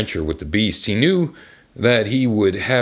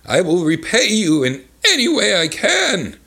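much higher, hammed-up plea; desperate storybook begging; pleading; 10 semitones higher, 3 dB louder than usual. A narrator's reading. Merchant promising the beast repayment, high pleading. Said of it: "pleaded the merchant".

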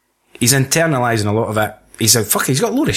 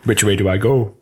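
mocking Anakin's bad acting; 4 semitones lower than usual. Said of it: "that was a wee bit shitty acting".

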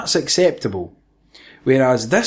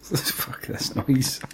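slow, much softer unvoiced "Fuck this noise"; muttered whisper; 10 dB quieter, much slower than usual. Real, not acted. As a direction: breathe it out in disgust. Muttered dismissal.

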